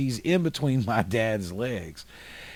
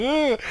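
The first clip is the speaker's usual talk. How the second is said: high-pitched mock animal groan; strangled high yelp, acted out; imitated possum noise. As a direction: squeal out an imagined speared-possum noise. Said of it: "it would have made an incredible noise".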